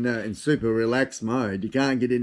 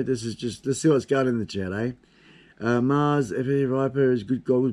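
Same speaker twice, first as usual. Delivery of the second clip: normal talking voice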